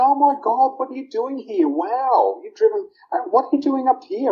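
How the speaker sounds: high, astonished voice of startled parents; shocked parents. Retold or imitated. Parents amazed their son drove two hours, high and astonished. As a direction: pitch it up as if caught off guard at the door.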